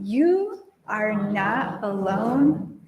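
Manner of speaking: very slow, spaced words for the congregation to follow; slow call to repeat. Shown as a demonstration. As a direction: lead them word by word. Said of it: "Can you say that with me?".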